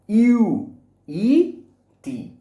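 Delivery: ordinary talk, nothing marked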